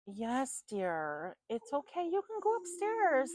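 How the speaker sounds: high, loud coaxing pet voice; talking to the dog. Real, not acted. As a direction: sweet-talk the dog into going upstairs. Coaxing her dog upstairs.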